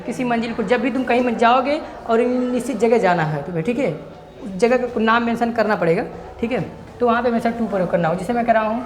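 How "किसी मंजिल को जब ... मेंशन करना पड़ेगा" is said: ordinary talk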